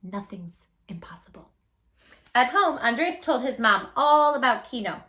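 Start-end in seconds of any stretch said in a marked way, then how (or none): 0.0-1.5 hushed whisper to listeners; whispered aside. Real, not acted. Host whispering encouragement to kids. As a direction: whisper it like a secret truth.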